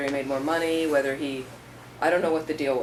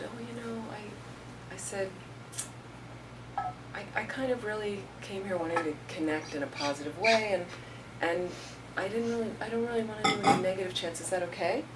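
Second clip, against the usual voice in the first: soft, higher, kindly asking; gentle and nice; 4 semitones higher, 8 dB quieter than usual. Retold or imitated. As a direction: ask her nicely, no pressure. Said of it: "I just turned to her really nicely".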